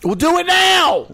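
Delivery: high, loud shout; yelling. Real, not acted. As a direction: yell it at him. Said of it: "Why are you yelling?".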